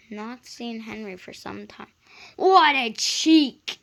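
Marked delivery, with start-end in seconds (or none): 2.4-3.8 slow, loud, outraged splutter; spluttering indignation. A narrator's reading. Gordon offended by Henry's greeting, slow loud splutter. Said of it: "he spluttered".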